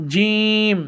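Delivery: forceful Arabic jim; hard jim. Shown as a demonstration. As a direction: press জিম out firmly. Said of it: "শক্ত একটি হরফ".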